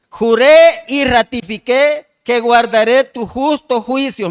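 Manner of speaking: emphatic Bible recitation; scripture proclamation. Acted. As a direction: proclaim the verse word by drawn-out word. Reads next psalm verse aloud.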